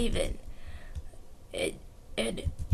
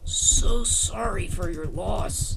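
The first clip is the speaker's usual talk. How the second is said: slow, low, hushed condolence; solemn sympathy; 6 semitones lower, slower than usual. Acted. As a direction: offer sorrow slowly. Condolence in play.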